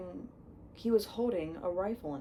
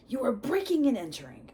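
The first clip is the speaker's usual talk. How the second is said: loud, high indignant order; angry demand; 7 semitones higher, 8 dB louder, faster than usual. Acted. Uncle ordering the giant out, loud and indignant. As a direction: bluster like an outraged householder. Vernon protesting.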